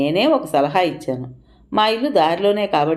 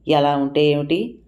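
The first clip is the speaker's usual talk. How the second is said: ordinary delivery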